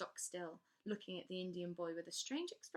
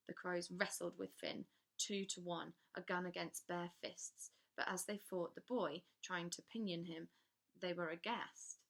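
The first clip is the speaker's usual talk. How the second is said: usual speaking voice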